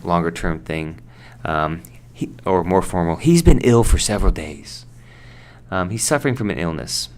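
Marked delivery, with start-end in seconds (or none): 3.2-4.8 example line. Acted. Example sentence acted.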